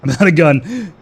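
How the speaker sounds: laughing loudly through the line. Real, not acted. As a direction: laugh it out in disbelief. Laughs, runs out of ammo.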